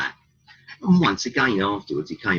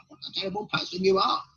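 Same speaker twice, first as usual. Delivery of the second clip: high, quick, mocking voice of the politically correct; mock outraged scold; faster than usual. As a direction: lampoon a prissy finger-wagger, pitched well up and fast. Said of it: "politically correct side of things".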